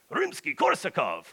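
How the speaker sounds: Russian-accented mock curse, pitched high; frustrated outburst in a Russian accent; North. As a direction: swear in composer names like a Russian Cossack. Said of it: "Russian voice. They kinda paint him as this Russian Cossack guy".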